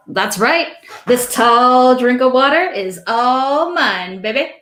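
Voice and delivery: lightly voiced dwarf showing off his partner; Dimly flirting. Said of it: "And he says".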